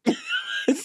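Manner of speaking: shrieking high laugh; high squealing laugh. Real, not acted. Laughing at a joke.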